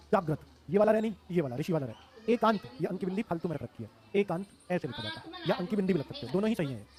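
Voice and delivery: ordinary talk, nothing marked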